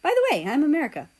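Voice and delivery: bright, quick and cheery; a fast, upbeat hello; cheerful reply. A narrator's reading. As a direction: answer with a grin in the voice. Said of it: "he answers with a smile".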